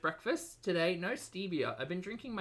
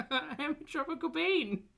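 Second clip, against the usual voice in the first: much higher, sing-song and playful; 11 semitones higher than usual. Acted. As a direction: pitch it way up, teasing. Repeats line playfully.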